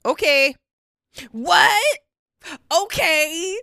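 hammed-up imitation of a baffled friend; mock friend popping in. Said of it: "and you're just gonna go".

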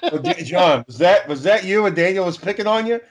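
usual speaking voice

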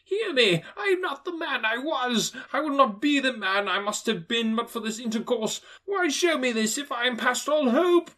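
put-on high, desperate old miser; frightened old man begging the ghost; pleading Scrooge. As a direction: plead for a second chance.

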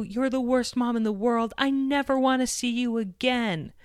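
high mock tantrum voice; a mom voicing her angry daughter; imitating shouting child. Mom retelling her daughter's shouted tantrum, high mock voice. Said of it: "she started shouting".

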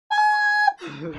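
big loud cackle; a sudden loud laugh; loud laughing burst. Real, not acted.

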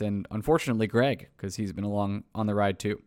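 ordinary talk, nothing marked

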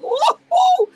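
high, loud whooping cheer; squealed hammed-up woo-hoo; mock cheer. Her own retold cheer.

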